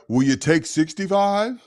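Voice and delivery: high-pitched lowballing buyer; pushy buyer voice. Retold or imitated. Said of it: "I hate Marketplace".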